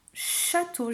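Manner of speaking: "Château" with a long opening shhh; stretched first sound. Shown as a demonstration. Teacher showing where the sh sings, stretched first sound. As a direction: lean on the sh of "Château" before the vowel. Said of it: "j'entends le chat qui chante dans château".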